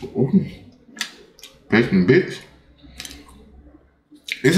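talking between bites; muffled by food; mouth full, chewing. Real, not acted. Seen 0: Bit burrito at -7; jaw and lips look mid-chew while talking.

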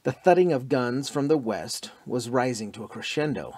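ordinary delivery

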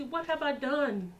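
soft, slow, meek question; the soft version David never used; timid. Retold or imitated. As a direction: say it meekly, the wrong way. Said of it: "David didn't say that all nicely".

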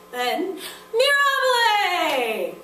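slow, high, loud "Mirabile!" stretched out; mirabile exclamation. Shown as a demonstration. Teacher showing the class the mirabile cheer. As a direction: cry out "mirabile!" in wonder. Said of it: "This is for two things".

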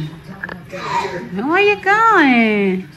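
hugely stretched singsong words; drawn-out playful call. Real, not acted. Mom to baby who's off, very drawn-out playful call. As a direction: draw GOING right out, teasingly slow. Calls again, much more drawn out.

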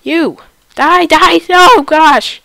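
loud, high frantic yelling; panicking gamer. Real, not acted. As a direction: yell in a panic, sure you'll die. Gamer panicking, about to die.

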